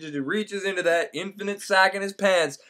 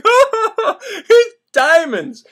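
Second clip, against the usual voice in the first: high, slow, crying out in delight; 6 dB louder, much slower than usual. Retold or imitated. Narrator spots a hand and gasps at diamonds, high delighted cry. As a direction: squeal it out over the diamonds. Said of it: "I'm like".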